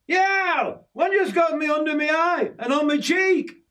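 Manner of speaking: very high-pitched pained cry; panicked yelp. A narrator's reading. Yorkie stung under the eye while running, panicked yelp. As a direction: squeal it out as if just stung.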